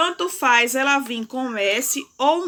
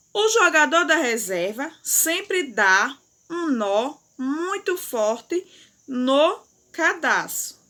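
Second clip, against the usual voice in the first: "O jogador da reserva sempre dá um nó muito forte no cadastro" read slowly, word by word; slow read-aloud; slower than usual. Shown as a demonstration. Teacher reading the sentence aloud slowly for pupils. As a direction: read it out slowly for the class to follow. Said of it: "leia em voz alta a frase".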